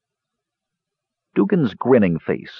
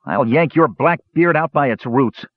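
understated hot-tempered threat, pitch raised; mildly furious; angry; 7 semitones higher than usual. A narrator's reading. Hot-tempered brother threatening the dwarf, angry. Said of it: "voice literally shook with anger".

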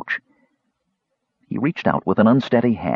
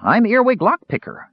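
high, self-important kender; 9 semitones higher than usual. A narrator's reading. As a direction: puff up proudly. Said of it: "said the Kender importantly".

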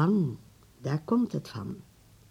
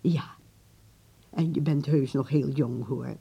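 ordinary talk

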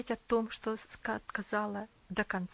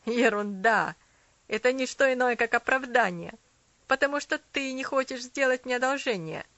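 put-on loud scornful boy; angry retort; 2 semitones higher, 9 dB louder than usual. A narrator's reading. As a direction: throw it back angrily. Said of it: "зло возразил мальчик".

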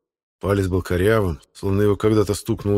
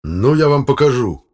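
slow, loud, high-pitched threat; furious threat; 7 semitones higher, 7 dB louder than usual. Acted. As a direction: storm off threatening them. Furious chairman threatens on leaving. (гневная протяжная угроза)

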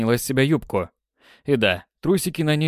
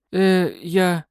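flustered mumble; 6 semitones higher than usual. Acted. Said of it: "растерянно промычала".

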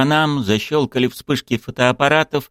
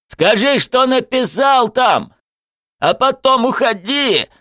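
high, drawn-out furious demand; straining shout; 14 semitones higher, 4 dB louder than usual. A narrator's reading. Coach demands what Zagir wrote, shouting hard. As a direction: keep yelling, stretching the words. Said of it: "но он продолжал".

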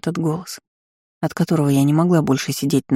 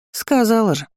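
mildly scornful, pitched up; snorting; 7 semitones higher than usual. A narrator's reading. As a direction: toss it off with high-pitched irritation. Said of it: "фыркнул голос". (фыркая, повыше)

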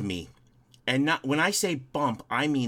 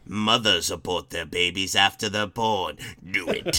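low, sinister villain impression; Emperor Palpatine; 7 semitones lower than usual. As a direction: intone it like an evil emperor. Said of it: "Emperor Palpatine had".

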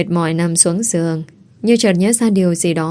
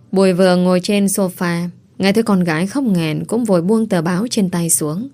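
usual speaking voice

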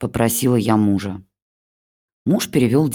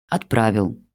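ordinary delivery, nothing marked

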